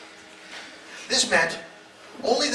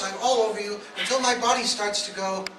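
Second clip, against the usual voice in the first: ordinary delivery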